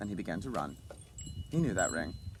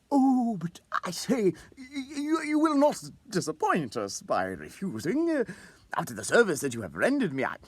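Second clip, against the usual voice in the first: much higher, jovial, stumbling on the words; good-humored insisting; 9 semitones higher than usual. A narrator's reading. Old clerk cheerfully insists his colleague come to dinner, good-humored. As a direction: press the invitation cheerfully, hamming the bonhomie. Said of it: "insisted in a good-humored tone".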